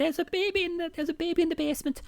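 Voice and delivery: high, fast, hammed-up fib; a high mocking imitation of Libby's lure; silly high voice. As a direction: gabble the lie in a squeaky voice. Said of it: "she'd be like".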